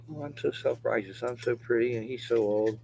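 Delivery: high mocking whine of a pretty critic. Mimicking viewers who call him old, a mocking high voice. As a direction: sneer it in a prissy high voice. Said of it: "That's what they're saying".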